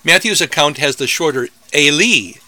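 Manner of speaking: ordinary talk, nothing marked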